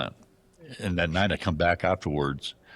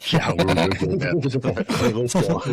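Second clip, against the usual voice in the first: louder, higher, laughing through it; laughing reply; 6 semitones higher, 5 dB louder than usual. Retold or imitated. Narrator laughing at an eastern guide over a small hill. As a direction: laugh off a tiny slope. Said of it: "I'm like".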